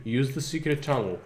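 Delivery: usual speaking voice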